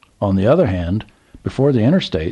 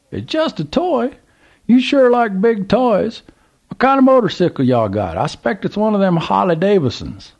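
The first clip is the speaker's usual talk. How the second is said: put-on old Southern gentleman; 12 semitones higher, 3 dB louder than usual. A narrator's reading. Old man calls to trucker, high Southern voice. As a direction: play the curious old man at the gas pump.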